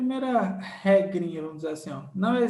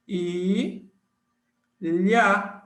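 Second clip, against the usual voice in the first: "i", long pause, "lha"; split by syllables; 3 dB louder than usual. Shown as a demonstration. Teacher shows where ilha divides, split by syllables. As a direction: sound out I and LHA as separate beats. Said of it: "Então, fica".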